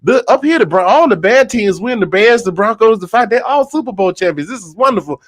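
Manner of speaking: put-on high, excited girlfriend chatter; gossipy women. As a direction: gush like a delighted church lady. Women voiced in heaven joke.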